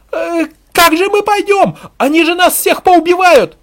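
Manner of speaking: high, loud panicked wail; hysterical woman. A narrator's reading. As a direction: shriek it in terror from the crowd. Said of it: "Истеричный женский голос".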